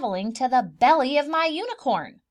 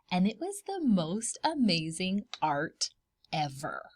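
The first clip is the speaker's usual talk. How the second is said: hushed awe; 5 semitones lower than usual. Acted. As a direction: breathe it like it's truly special. Awed at the artwork.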